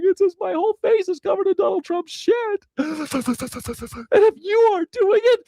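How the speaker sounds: high, squeaky groveling sycophant voice; hammed-up toady cackling through his boasts; mock bootlicker. Mocking a Trump bootlicker.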